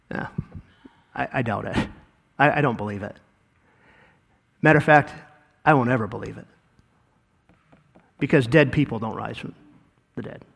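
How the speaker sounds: lightly voiced doubting disciple; doubtful Thomas. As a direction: murmur it like a doubter.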